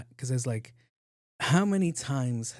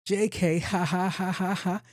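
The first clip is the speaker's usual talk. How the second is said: higher, forced ha ha; mock nervous laugh, pitched up; fake laugh; 7 semitones higher, slower than usual. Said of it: "She was like".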